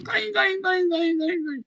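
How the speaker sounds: very high, loud dog yelps; shrill loud yelping kain kain. Retold or imitated. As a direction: yip like a kicked puppy.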